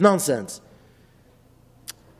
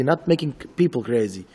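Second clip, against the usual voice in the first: usual speaking voice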